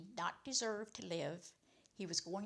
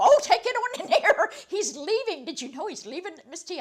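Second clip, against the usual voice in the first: high, loud and bubbling over with excitement; guard cheering that Carl is leaving; excited guard; 10 semitones higher, 14 dB louder than usual. Retold or imitated. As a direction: gush like a thrilled guard letting her in.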